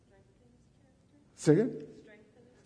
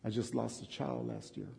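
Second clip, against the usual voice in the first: grieving confession; 5 semitones lower, 13 dB quieter than usual. Shown as a demonstration. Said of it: "How about this?".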